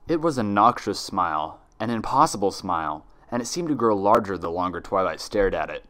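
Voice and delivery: plain reading voice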